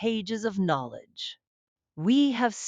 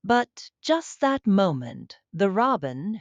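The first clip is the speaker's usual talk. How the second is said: usual speaking voice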